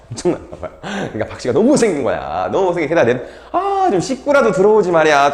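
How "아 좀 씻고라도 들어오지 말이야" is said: exasperated grumble. Acted. Grumbling in the disgusted groom's place.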